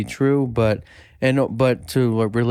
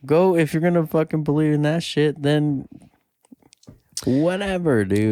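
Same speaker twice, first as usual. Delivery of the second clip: higher-pitched, fed-up comeback; dismissive retort; 4 semitones higher than usual. Retold or imitated. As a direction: wave them off in a raised pitch. Retelling her dismissive retort.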